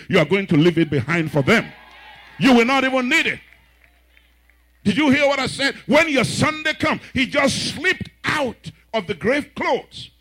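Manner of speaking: loud, high preaching shout; full-force preacher's cry. Real, not acted. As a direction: drive it home to the congregation.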